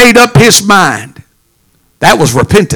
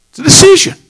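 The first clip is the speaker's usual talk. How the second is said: "It's a decision" pitched much higher, rising; high preaching lift; 11 semitones higher, much faster than usual. Acted. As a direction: lift the refrain up high and ring it out. Sermon refrain, lifted high.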